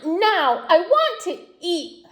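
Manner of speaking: high doll voice; a spoiled doll making demands; demanding doll. A narrator's reading. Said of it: "said the doll".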